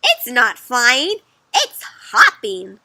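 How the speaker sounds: slow, loud storybook correction; emphatic, drawn-out rebuttal; firm correction. Acted. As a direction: contradict them, leaning on each word. Other character contradicts.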